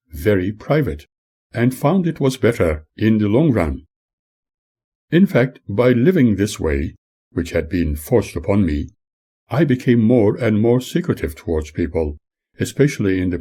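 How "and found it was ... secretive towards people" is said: ordinary delivery, nothing marked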